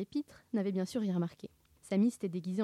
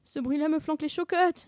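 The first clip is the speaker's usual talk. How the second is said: high, loud whine; fearful moan; 8 semitones higher, 5 dB louder than usual. A narrator's reading. Sammy locked in the chest, whining with fright. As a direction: moan it like a scared coward. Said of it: "gémit Sammy".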